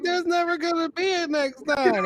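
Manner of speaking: mock despair. Retold despair to Kofi.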